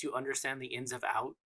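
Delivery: normal talking voice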